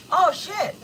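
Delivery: high, loud startled "oh, shit"; imitating his shocked blurt; mock shocked husband. Quoting her husband's reaction, mock shocked. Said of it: "you know what he said?".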